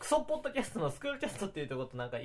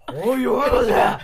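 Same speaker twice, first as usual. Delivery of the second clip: shouting; 8 semitones higher, 14 dB louder than usual. Pretend. Scolding again.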